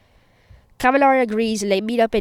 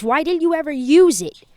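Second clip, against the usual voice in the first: higher, louder accusing yell; mock scream; 7 semitones higher, 5 dB louder than usual. A narrator's reading. Bakugo demands an answer from Deku, mock screaming. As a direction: yell it up high without full screaming. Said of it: "And then he screams".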